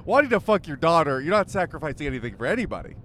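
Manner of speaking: normal talking voice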